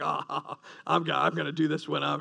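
low, hushed, quick scheming chuckle; mock a sly teen secretly plotting; sneaky mock glee. Teen thinks he's hiding plans from parents, sneaky mock glee. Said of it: "you think you're hiding it".

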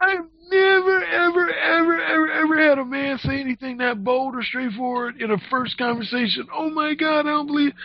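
high squealing mock disbelief; hammed-up shrill amazement; shocked woman. Woman stunned by his bold words, high squealing. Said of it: "she just was like".

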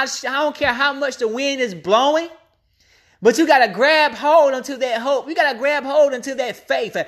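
ordinary delivery

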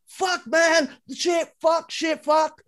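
high-pitched imitation of a swearing fit; mock yelling. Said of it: "And you're just yelling".

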